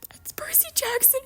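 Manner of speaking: very high, thin whispered squeal; squeaky fangirl whisper. Pretend. Fan sees perfect Percy casting, whisper-squealing.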